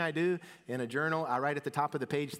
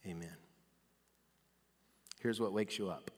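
usual speaking voice

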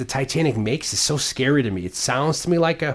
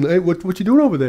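mock Russians; 6 semitones higher than usual. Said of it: "Russians are ... looking".

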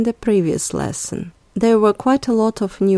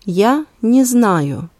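slow, careful teacher model; slow for learners; slower than usual. Shown as a demonstration. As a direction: stretch "Я не знаю" out slowly so a learner can copy it. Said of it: "let's repeat both sentences one more time".

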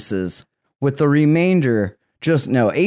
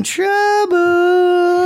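high mock warning; 4 dB louder than usual. Sudden put-on voice.

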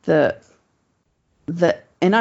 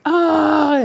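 sudden high pained yelp; a sharp high cry as the swab goes in; 12 semitones higher, 3 dB louder than usual. Retold or imitated. Patient yelps as the nurse swabs her nose, high and pained.

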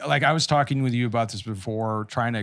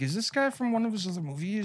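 puzzled inner voice; 9 semitones higher, 5 dB quieter than usual. Retold or imitated. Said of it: "I was like".

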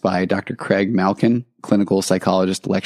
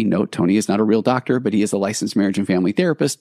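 usual speaking voice

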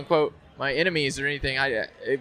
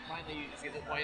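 normal talking voice

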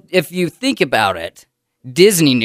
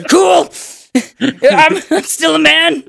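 mock proud exclaim; 3 dB louder, slower than usual. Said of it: "And I was like".

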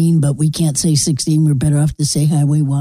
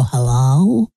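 a slightly lower, drawn-out radio-host hello; radio caller voice; 4 semitones lower than usual. Retold or imitated. Calling the sports talk show, mock radio hello. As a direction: greet the sports show like an old radio pro. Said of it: "I call him up and go".

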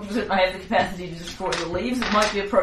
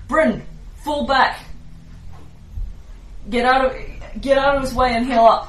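raised urgent call; 5 semitones higher than usual. Acted. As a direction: call out to an ally in battle. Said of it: "will call out to her".

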